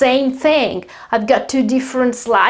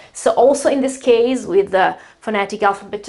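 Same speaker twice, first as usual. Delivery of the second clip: ordinary delivery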